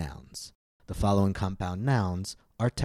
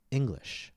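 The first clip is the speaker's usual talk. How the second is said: "English" with a longer, higher first syllable; stress on first syllable; 5 semitones higher than usual. Shown as a demonstration. Teacher demonstrating word stress to learners, "English" stressed on ENG. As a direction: stretch and lift the "Eng" in "English". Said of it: "vowel in the first syllable is longer".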